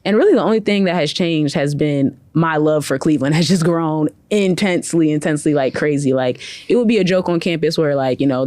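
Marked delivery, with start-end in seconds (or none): none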